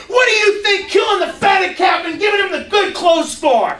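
put-on angry older brother. The older brother confronting his father, high and indignant. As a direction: shake your finger and demand why.

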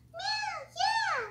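high, soft meow; cat meow. Pretend. Toy cat agrees to the park trip with a soft meow. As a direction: meow it like a little kitten.

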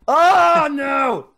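sudden high yelp of dismay; surprised shout. Real, not acted. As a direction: cry out as you die. Dies suddenly in game.

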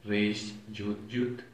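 "Reish Yud Yud" very slowly, word by word; slow, deliberate "Reish Yud Yud"; Aramaic pronunciation. Shown as a demonstration. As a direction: spell out "Reish Yud Yud" as three separate, drawn-out letter names. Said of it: "En arameo se pronuncia".

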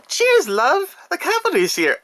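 put-on squeaky overdone British accent; bad Tracer British accent. Collector imitates Tracer for fans, in a badly faked British accent. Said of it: "try and do my impression here".

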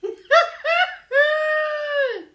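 long, high-pitched squeal of laughter. Real, not acted. Host opening the reaction, high squealing laugh. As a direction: let out a drawn-out high cackling squeal.

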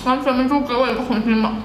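talking while chewing food; muffled words around food; mouth full, chewing. Real, not acted. Seen 1: Lips closed around food, sauce on mouth, chewing throughout the words.

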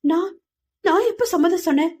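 high, bewildered stammering question; confused. Acted. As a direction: when did I ever agree?. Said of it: "மகி குழப்பத்தில் மூழ்க".

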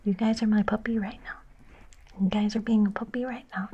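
ordinary talk, nothing marked

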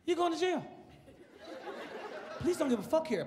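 ordinary talk

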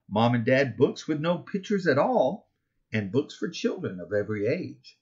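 ordinary delivery

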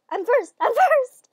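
rising, disbelieving triumph; elated victory cry. Real, not acted. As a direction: squeal it out, overjoyed at winning. Celebrating first place.

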